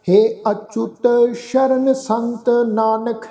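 drawn-out, syllable by syllable scripture reading; slow recitation. Shown as a demonstration. Teacher reciting the hymn slowly for the congregation. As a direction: lay each syllable down slowly and reverently. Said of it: "Nanak ਤੇ ਰੁਕਾਂਗੇ ਜੀ".

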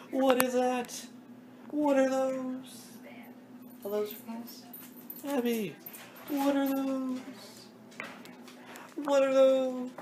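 high-pitched slow "what are those"; drawn-out squeaky meme voice; high meme squeak. Pretend. Teasing the dog in a squeaky meme voice.

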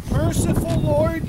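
normal talking voice

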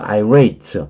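irate with the weight on rate, eye-RATE, not on the i; stress on the second syllable. Shown as a demonstration. Teacher showing where the stress falls, eye-RATE. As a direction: keep the i light and lean on rate in irate. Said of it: "late의 강세가 있어요".

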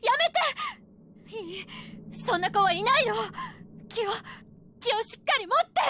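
loud, high panicked pleading; frantic shouting. Acted. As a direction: shout to stop her. Mother restraining daughter.

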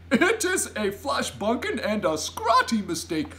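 loud, high, swooping giant tirade; giant's exasperated cry. A narrator's reading. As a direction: scold with comic exasperation and hoots. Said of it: "cried the B F G".

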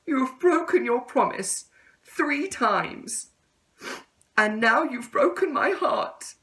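every word aching with hurt; heartbroken caterpillar. Acted. Caterpillar telling the tadpole he broke her heart, high and pained. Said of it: "said the caterpillar".

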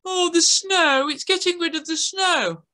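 put-on high, gushing little girl; girl gasping at how festive it is; excited girl voice. A narrator's reading. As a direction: squeal it like a delighted girl. Girl character's voice.

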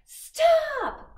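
sudden loud high shout; cry. Acted. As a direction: shout it to halt them. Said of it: "cried Poppy".

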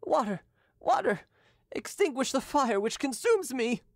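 mild anguished plea; lightly voiced dying youth, in agony. A narrator's reading. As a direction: beg for relief from the burning. Dying boy begging for water.

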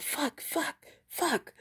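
high, hushed, sputtered curses; mock a demon cursing under its breath; frustrated demon cursing. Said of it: "he was just like".

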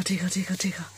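whispered tickle tickle; playful whisper. Pretend. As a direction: whisper it teasingly to the baby. Tickling the baby.

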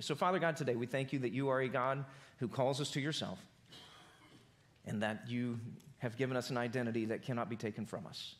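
soft, hushed pastor's prayer; gentle fading prayer voice; closing prayer. Acted. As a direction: pray quietly over the congregation.

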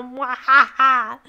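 loud, slow villain cackle; mock evil laugh. Mock villain laugh after puns.

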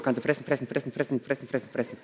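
low, soft running chant; 単調な反復. Acted. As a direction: rattle off the repeated word like a droning machine. Comic chant of endless gorging.

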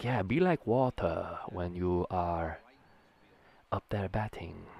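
slow, calm martial arts master; lightly voiced Bruce Lee, unhurried and serene; a hint of Bruce Lee giving batting advice; Bruce Lee impression. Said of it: "my impression of Bruce Lee".

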